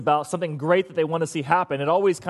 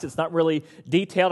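ordinary talk

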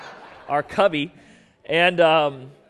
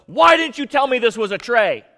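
loud, higher exasperated demand; angry retort; 7 semitones higher, 7 dB louder than usual. Retold or imitated. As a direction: fire the question at your wife, stung by pain. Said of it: "the only thing what I could think of was".

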